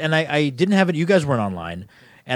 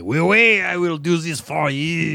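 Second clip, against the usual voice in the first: mock French accent; 3 semitones higher, slower than usual. Joking about the French teammate Dupree, mock French accent. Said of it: "That's kinda exactly what it sounded like".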